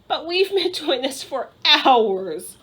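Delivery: put-on childish wail of boredom. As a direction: drag out the complaint. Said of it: "wailed Megan".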